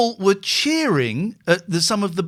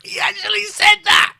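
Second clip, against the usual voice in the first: laughing; 8 dB louder than usual. Real, not acted. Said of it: "laughing shout".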